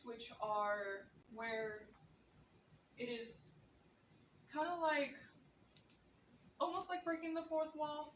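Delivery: ordinary talk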